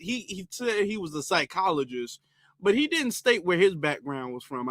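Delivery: normal talking voice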